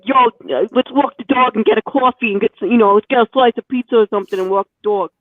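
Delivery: thick Brooklyn dude. Acted. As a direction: lay on a thick Brooklyn accent, like some Italian guy. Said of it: "with the thickest Brooklyn dude accent ever".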